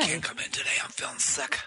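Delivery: soft, weak mock sick-call voice; feigned sickness. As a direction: play the machine feebly calling in sick. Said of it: "Oh, is it sick?".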